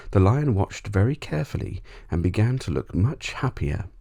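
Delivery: usual speaking voice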